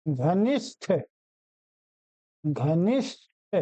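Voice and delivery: घनिष्ठ low and careful, final th released separately; correct pronunciation. Shown as a demonstration. Teacher repeats the correct form, घनिष्ठ carefully with released th. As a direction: breathe the gh and release the end of घनिष्ठ. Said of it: "ये शुद्ध हुआ".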